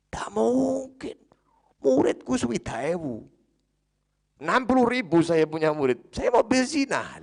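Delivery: high, loud outraged protest; acted scandalised holy man, high and loud; indignant refusal. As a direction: cry out in shocked indignation at the very idea. Barsiso indignantly refuses devil's suggestion.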